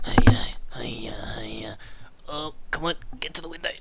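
hushed urgent whisper. Real, not acted. Calling someone at night in a hushed whisper. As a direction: keep it down, don't wake anyone.

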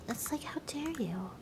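breathy mock how dare you; whispered aside. Streamer whispering a mock outrage aside, whispered. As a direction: whisper it to chat.

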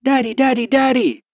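put-on high little girl calling over and over; excited child. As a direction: bounce with joy like a small child. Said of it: "Molly shrieked".

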